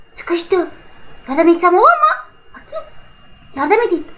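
normal talking voice

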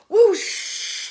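high loud blowing wind; whoosh. A narrator's reading. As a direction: blast of wind. Wolf blows house down.

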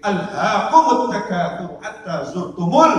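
loud, drawn-out verse recitation; Quran recitation. Real, not acted. Preacher reciting the Quran to the congregation, loud recitation. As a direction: recite the verse slowly and forcefully.

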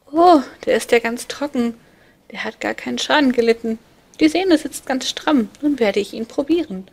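put-on high, loud, boastful child's voice; cheeky little boy. A narrator's reading. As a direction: brag like a mischievous little cupid. Amor voiced by narrator. (hohe, laute, prahlerische Kinderstimme)

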